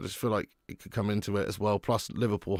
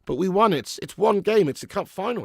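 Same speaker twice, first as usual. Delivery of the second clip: higher and louder, insistent fan's conviction; fired up; 8 semitones higher, 7 dB louder than usual. Real, not acted. Fan insisting to viewers that a cup final is anyone's game. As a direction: punch it out like proving a point.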